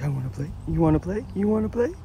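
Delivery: voicing the pet. Speaking for her playful pet, a rising eager voice. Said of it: "He's like".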